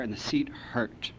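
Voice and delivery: slow, breathless whisper; whispered pain. Real, not acted. Recovering drunk tells the room how raw he felt, in a slow whisper. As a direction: let it ache out.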